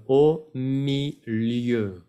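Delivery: "Au milieu" very slow, falling at the end; French pronunciation demo. Shown as a demonstration. Teacher repeats the vowels for learners, "Au milieu" slowly separated. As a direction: draw out O, mi, lieu in "Au milieu". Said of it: "las letras O y E".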